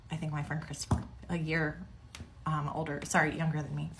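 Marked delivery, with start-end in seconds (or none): none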